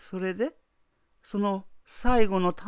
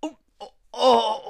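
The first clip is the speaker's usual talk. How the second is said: pained gasping cry; 5 dB louder, faster than usual. Acted.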